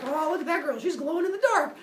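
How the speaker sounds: high-pitched gawking wonder; amazed onlookers. Acted. Villagers spot glowing Athena, high mock amazement. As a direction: exclaim it like a gawping crowd. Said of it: "all the people are like".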